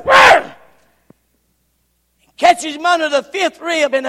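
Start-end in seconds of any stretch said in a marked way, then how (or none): 0.0-0.5 high loud spear-thrust roar; battle yell. Acted. Abner thrusting his spear at Ashiel, loud battle roar. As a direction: roar as you throw the spear. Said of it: "he takes a spear and goes".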